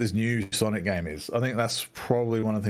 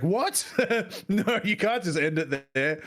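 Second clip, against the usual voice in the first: high-pitched mock shock with a laugh; laughing, high re-enactment of disbelief; imitated disbelief; 8 semitones higher, slower than usual. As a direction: mimic her shocked protest, pitched way up. Imitating her shocked reaction to ending.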